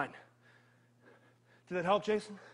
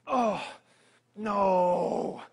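mock horror; slower than usual. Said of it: "What's happening to my body?".

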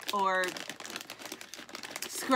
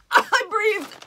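laughing; 10 semitones higher, 9 dB louder than usual. Real, not acted. Laughing through wasabi.

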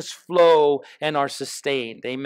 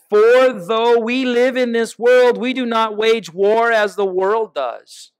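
preaching at full force; 3 semitones higher, 4 dB louder than usual. Acted. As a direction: preach it out to the whole church. Proclaims the verse at full force.

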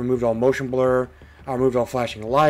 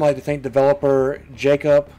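ordinary talk, nothing marked

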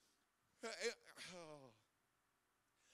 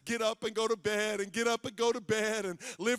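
sing-song mock lulling; 11 dB louder than usual. Voicing the lulling stuff.

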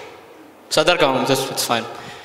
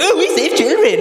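mock do-gooder; 9 semitones higher than usual. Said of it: "children and be like".

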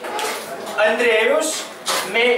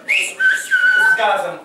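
whistled; much slower than usual. Shown as a demonstration. Whistled phrase demo.

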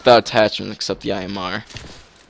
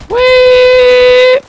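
high gleeful whee; 12 dB louder than usual. Real, not acted. Gamer launched through air.

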